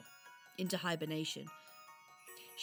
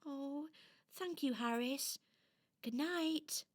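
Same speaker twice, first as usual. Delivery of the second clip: touched little doll; 7 semitones higher, slower than usual. Acted. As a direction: say a sweet good night as a tiny doll. Touched doll says good night.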